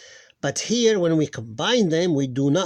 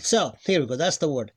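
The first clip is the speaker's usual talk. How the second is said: usual speaking voice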